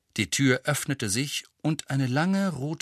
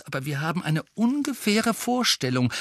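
higher, anxious-to-appease addition; hasty; 7 semitones higher than usual. A narrator's reading. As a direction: add it hastily to head off anger. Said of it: "ergänzte sie hastig". (hastig ergänzend)

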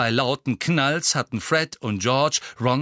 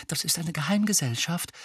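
higher, hurried explanation; 7 semitones higher than usual. A narrator's reading. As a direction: get the explanation out in haste. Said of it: "sagte Hermine eilig". (eilig erklärend)

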